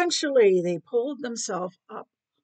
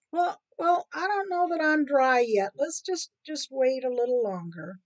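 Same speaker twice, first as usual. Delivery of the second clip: higher, hesitant rat voice; stalling rat; 4 semitones higher than usual. Acted. Rat puts off hungry cat, hesitant. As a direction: stammer a little, playing for time.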